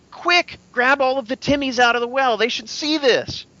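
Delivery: high, loud excited shout; townsfolk shouting. Acted. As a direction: call out to the whole town, thrilled. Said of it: "you hear people, uh, shouting".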